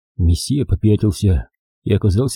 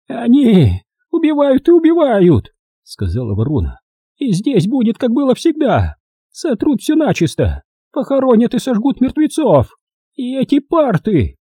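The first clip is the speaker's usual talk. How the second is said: creaky crow voice; 13 semitones higher, 3 dB louder than usual. A narrator's reading. Old crow prophesying killing to the boys, high and creaky. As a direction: rant like a creaking old witch.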